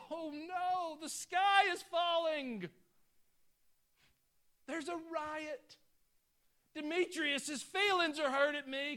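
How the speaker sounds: high, loud, slow hammed-up fretting; mock panic. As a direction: play a frantic worrier, the sky is falling. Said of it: "We scurry around sometimes".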